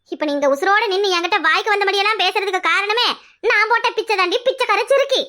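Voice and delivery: high-pitched, heated insults; furious. Acted. Women trading threats and insults in a family quarrel, furious. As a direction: attack her, building to the final insult.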